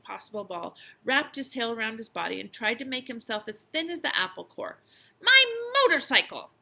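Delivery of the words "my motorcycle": high, loud cry of outrage; boy yelling over a loss; shouted alarm. A narrator's reading. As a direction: shout it in high-pitched dismay. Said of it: "shouted the boy".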